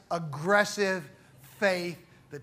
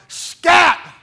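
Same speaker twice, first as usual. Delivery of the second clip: sudden shout, much higher and louder; yelled command; 10 semitones higher, 14 dB louder than usual. Retold or imitated. Preacher acting the lady shooing her dog, full yell. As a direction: bark one word at the dog with full force. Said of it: "At the top of her lungs, she yelled".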